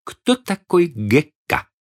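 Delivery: probing question. A narrator's reading. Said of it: "пристально взглянув на него".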